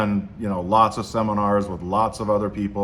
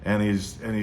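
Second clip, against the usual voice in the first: ordinary delivery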